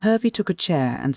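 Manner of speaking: ordinary delivery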